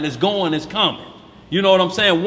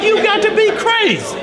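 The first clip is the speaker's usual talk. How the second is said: high, loud, laughing disbelief; mock incredulous woman; 4 dB louder than usual. Mocking his woman, high and laughing in disbelief. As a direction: squeal it like she can't believe you. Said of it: "You're like".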